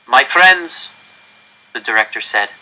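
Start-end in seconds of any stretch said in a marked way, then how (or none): 0.1-0.9 director's raised, higher public address; stern official. Acted. Director warning the crowd into the microphone, raised and stern. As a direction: command the whole square from the microphone.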